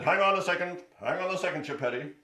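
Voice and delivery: loud bossy lion. Acted. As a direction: hold them off like a king. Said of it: "And Lion said".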